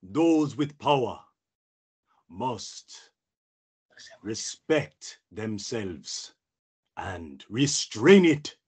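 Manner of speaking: put-on slow, measured accented Jedi master; Kit Fisto. Jedi teaching restraint, slow and solemn. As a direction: weigh each phrase with long pauses. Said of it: "That was my green friend, the Jedi".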